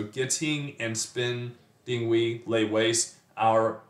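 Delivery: "getting and spending" in slow, even metrical beats; scanned iambic meter. Shown as a demonstration. Teacher showing how the line scans, slow iambic beat. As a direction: chop "getting and spending, we lay waste" into two-syllable feet. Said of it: "fits the iambic pentameter".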